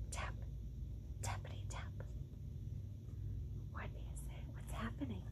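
hushed storytime whisper; suspense whisper. Acted. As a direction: draw the kids in with a secret. Reads suspense part in whisper.

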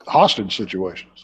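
normal talking voice

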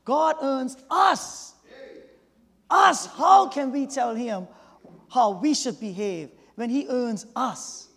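slow, loud, rising preacher cry; preaching shout. Real, not acted. Preacher crying out to the class, slow and loud. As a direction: drive it home to the congregation.

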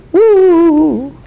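high, loud ghostly "woooo"; spooky wail. A narrator's reading. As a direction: wail like a haunted cave. Eerie wail of crying cave.

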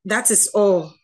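ordinary delivery

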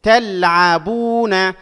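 very slowly stretch "بو" in "تلعبون"; loud, sounded-out "تلعبون" with long u; drawn-out long vowel. Shown as a demonstration. Teacher analysing the word for students, drawn-out long vowel. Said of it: "مد مع الممدود".

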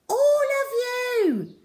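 high, slow shout up at windows; girl's shout. A narrator's reading. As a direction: call up to the flats at full voice. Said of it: "shouted Zoe up at them".

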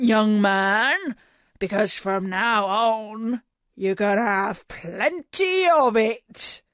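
loud, theatrical pirate captain; booming. A narrator's reading. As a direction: boom it out like a menacing pirate headmaster. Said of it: "he boomed".

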